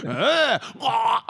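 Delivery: loud frustrated ugh, ah; angry girlfriend groaning; mad groan. Retold or imitated. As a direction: groan out her annoyance. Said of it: "She gets all mad".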